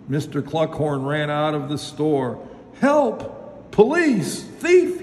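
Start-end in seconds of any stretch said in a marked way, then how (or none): 2.8-5.0 high-pitched cry for help; storybook shopkeeper yelling for police; shouted alarm. A narrator's reading. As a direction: call out each word in alarm, pitched way up. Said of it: "he shouted".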